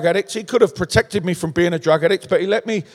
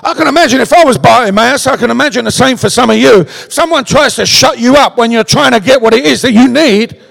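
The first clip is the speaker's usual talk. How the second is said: ordinary delivery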